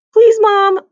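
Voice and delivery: high, loud pleading on please; begging. Acted. Boy begging his mom to skip the ear check. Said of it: "Andrew begged".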